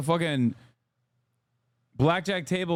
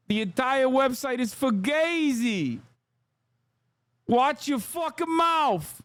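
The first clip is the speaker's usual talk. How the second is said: high, drawn-out New Jersey Italian wiseguy; 7 semitones higher than usual. Acted. Streamer mock-protesting anti-Italian hate speech, hammed-up New Jersey Italian. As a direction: put on a hammed-up Italian-American accent, pitched way up. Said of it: "That's pure New Jersey. ... the Italian slurs".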